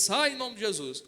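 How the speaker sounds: slow, measured rebuke in Jesus' name; steady deliberate exorcist command; firm command. Retold or imitated. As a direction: order the demon out calmly but firmly.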